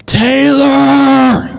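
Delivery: shrill, high pained scream of a name. Retold or imitated. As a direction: yell the name, hurt and furious. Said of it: "just scream".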